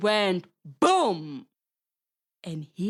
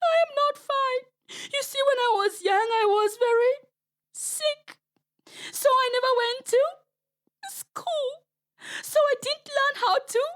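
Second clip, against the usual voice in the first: high, wailing crocodile tears; whimpering pretend sobs, pitched way up; fake crying. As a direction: sob it out like a trickster faking grief. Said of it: "Anansi began to cry".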